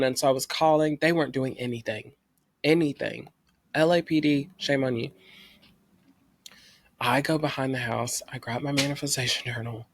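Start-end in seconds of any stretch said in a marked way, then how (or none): none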